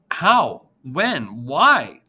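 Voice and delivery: high, loud stunned outbursts; three disbelieving cries; shocked questions. A narrator's reading. Girl reeling at news of grandpa's death, shocked cries.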